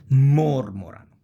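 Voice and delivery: mormorano with a loud, long opening mor; stress on first syllable. Shown as a demonstration. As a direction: punch MOR, rattle off morano. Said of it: "the beginning of the word".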